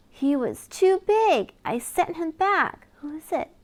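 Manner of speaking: lively storybook reading with big reveals; reading to children. Acted. Reader sharing a lift-the-flap book with a child, animated. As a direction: read it like bedtime story time for a toddler. Reads picture book aloud.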